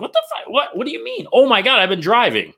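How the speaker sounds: high, flustered outburst; mock panicked realization. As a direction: act out a driver jolted from daydreams. Acts out distracted driver snapping to.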